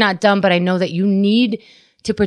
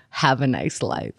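softer, slow and icy sign-off "Have a nice life"; cold dismissal; 6 semitones lower, 8 dB quieter than usual. Shown as a demonstration. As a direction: say "Have a nice life" as a curt, chilly goodbye. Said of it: "she could really mean".

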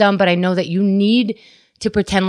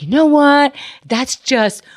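exasperated rant; 8 semitones higher than usual. Retold or imitated. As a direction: vent it like you've lost all patience. Ranting at husband's excuse.

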